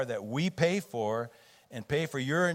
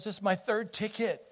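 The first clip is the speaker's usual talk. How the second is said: lightly voiced upset son, pitched higher; a touch of a shaken boy confessing; 9 semitones higher than usual. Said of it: "he's very upset".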